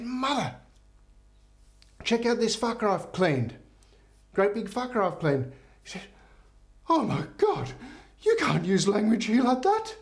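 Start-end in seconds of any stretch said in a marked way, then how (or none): 0.0-5.5 high-pitched acted pride; excited showing off. Bishop shows fish to nun. 6.9-9.9 very high hammed-up outrage; horrified nun. Mother Superior shocked by swearing.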